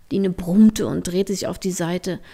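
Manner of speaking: usual speaking voice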